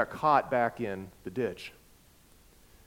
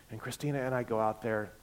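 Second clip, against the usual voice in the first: ordinary talk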